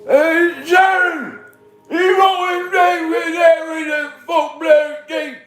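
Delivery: very high, loud, slurred and slow. Acted. Streamer doing impressions on request, slurred shouted rock-star impression. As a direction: shout it garbled, words smeared together.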